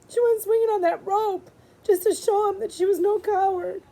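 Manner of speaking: narrating through tears; tearful. A narrator's reading. Narrator reading Jess's grief, tearful.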